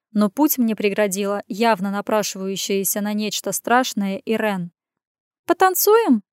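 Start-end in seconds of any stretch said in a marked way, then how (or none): 5.5-6.2 high purring invitation. Acted. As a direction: purr it, playful and high. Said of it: "мурлыкнула она".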